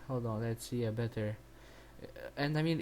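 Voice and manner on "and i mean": usual speaking voice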